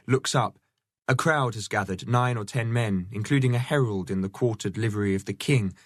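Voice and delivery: ordinary delivery, nothing marked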